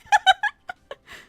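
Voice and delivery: loud high-pitched laugh. Real, not acted. Laughing at chat.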